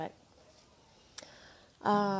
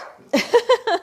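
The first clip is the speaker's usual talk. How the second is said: embarrassed laugh; 13 semitones higher, 10 dB louder than usual. Real, not acted. Laughs at her own voice cracking.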